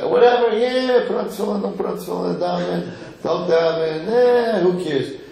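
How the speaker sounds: put-on high, dismissive whatever-kid voice; mock indifference. Uninterested kid waving off religious practice, mock indifference. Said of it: "just not interested".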